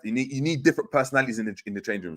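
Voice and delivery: ordinary delivery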